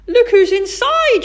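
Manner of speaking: high, loud, slow exclamation; astonished shout. A narrator's reading. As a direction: shout out a surprising discovery. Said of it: "shouted Kipper".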